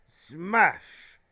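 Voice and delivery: sudden loud burst on the word; explosive smash. A narrator's reading. Narrator sounding the door being smashed in, explosive. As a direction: hit it like a door crashing in.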